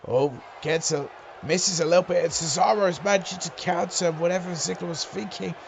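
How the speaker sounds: lively play-by-play call; wrestling commentator. Acted. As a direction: call the match as it happens.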